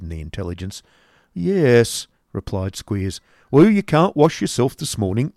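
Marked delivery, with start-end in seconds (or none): 1.3-5.3 higher, louder gruff schoolmaster; loud, gruff headmaster voice; Squeers waking him. Acted. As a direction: bark him out of bed. Squeers tells Nicholas.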